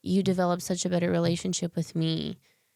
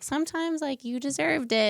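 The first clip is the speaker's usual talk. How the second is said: imitating a sassy mom, much higher; 9 semitones higher than usual. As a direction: pitch way up, cheeky and blunt. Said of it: "little sassy woman".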